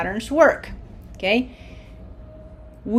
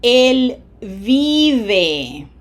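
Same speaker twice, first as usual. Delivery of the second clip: loud, drawn-out "vi" in "vive"; pronunciation emphasis; 2 semitones higher, 6 dB louder than usual. Shown as a demonstration. Teacher models he lives, long stressed "vi" in "vive". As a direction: stretch and stress the first syllable of "vive". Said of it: "Feel the pronunciation emphasis".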